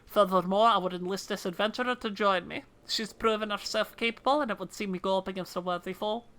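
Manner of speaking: dutiful officer in a put-on Scottish accent; fake Scottish accent. Officer asking the commander's permission to investigate, fake Scottish accent. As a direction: play a Scottish officer making a request. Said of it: "fake Scottish accents".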